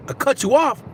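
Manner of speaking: high-pitched questioning rise; imitated surprised comeback; retold disbelief. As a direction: ask it as if baffled by the accusation. Acts out his own puzzled reply.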